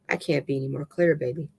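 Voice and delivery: plain reading voice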